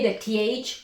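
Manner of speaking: ordinary talk, nothing marked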